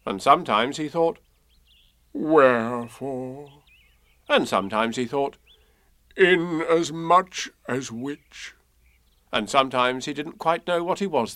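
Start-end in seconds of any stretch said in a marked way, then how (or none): 2.1-3.6 slow, sad donkey voice; Eeyore. A narrator's reading. Eeyore thinking to himself, sad. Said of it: "he thought sadly to himself". 6.2-8.5 slow, higher, mournful donkey; Eeyore. A narrator's reading. Eeyore puzzling sadly to himself, mournful. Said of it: "he thought sadly to himself".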